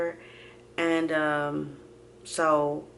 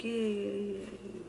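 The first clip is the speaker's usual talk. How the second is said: soft, higher noncommittal murmur; evasive; 4 semitones higher, 10 dB quieter than usual. Retold or imitated. Daughter trying not to talk to family, evasive. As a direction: brush them off quietly. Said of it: "Trying not to really talk to 'em".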